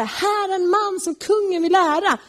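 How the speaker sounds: a herald's cry pitched high, but humiliated; reluctant herald's cry, high; forced proclamation. Retold or imitated. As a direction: call it out grandly while eating your pride. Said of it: "Han får gå där och ropa".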